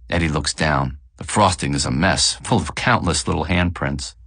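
plain reading voice

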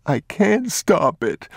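high, slow, mild crying; can't hold the tears back; boy crying to his father; tearful boy. Acted.

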